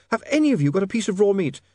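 high, pressing lady's voice; urgent young woman. Acted. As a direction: demand it urgently, pitched high. Sacharissa urgently asks the dwarfs.

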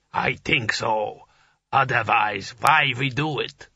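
slow, alien giant speech; giant booming voice. A narrator's reading. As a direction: speak slow and heavy like an ice giant. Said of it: "it said".

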